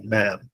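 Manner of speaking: "ma'am" as one syllable, the d of madam dropped; American short form. Shown as a demonstration. Teacher showing what Americans say, contracted to one syllable. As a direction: say "ma'am" softly as a single syllable. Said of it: "hear people say".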